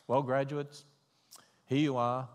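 ordinary talk, nothing marked

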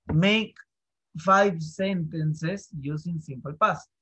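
slow, deliberate classroom instruction; slow dictation. Shown as a demonstration. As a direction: dictate the task word by word. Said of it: "teacher dictates the task". (instrucción dictada despacio)

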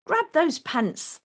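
high, loud pirate order; captain roars. Acted. Pirate captain roaring to his crew, loud and high. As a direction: roar it at the crew. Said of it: "the captain roars".

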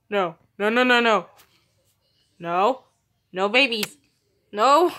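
panicking over breeding cats; panicked. Real, not acted. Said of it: "Sorry, guys, I'm panicking".

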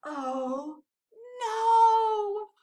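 drawn-out horrified exclamation, rising high and loud; dramatic storybook alarm; dismayed cry. A narrator's reading. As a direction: cry out in dread. Percy's shock after the gasp.

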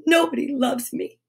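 mild storybook self-pity; sobbing. A narrator's reading. Noddy alone at home, feeling miserable, lightly sobbing. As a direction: lament it with a touch of a sob. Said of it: "he sobbed".

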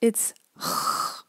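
"ch" as a rasping sound from the back of the throat; ach sound demo. Shown as a demonstration. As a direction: give a throaty German "ch". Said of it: "it's called the ach sound".

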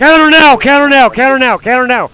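high, loud urgent yell; shouted command. Real, not acted. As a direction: bark it at the team. Calling a counterattack.

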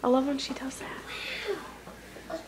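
soft slow whisper. Real, not acted. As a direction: whisper it so she won't hear. Whispered aside.

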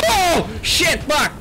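high-pitched alarmed yell; startled shout. Real, not acted. Player jumped by the ghost, high startled shout. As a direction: yelp and curse in sudden fright.

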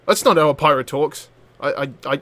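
ordinary talk

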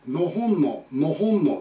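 のほん for Nihon, with a weakened "no" first; American's mispronunciation. Retold or imitated. Teacher imitating American acquaintance Dan, のほんの with stressed "hon". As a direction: lean on "ho" in のほんの. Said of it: "アメリカ人で一人ですね".

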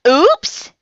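high, loud little oops; sheepish oops. A narrator's reading. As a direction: blurt it out on realizing your slip. Ian realizes his mistake.

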